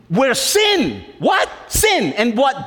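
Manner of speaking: high shouted what; sudden, shouted question; calling out. Real, not acted. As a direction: call it out to the room. Preacher calls to congregation.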